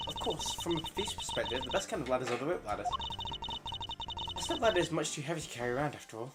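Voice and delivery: put-on high, bright young thief; high-pitched eager girl; perky girl voice.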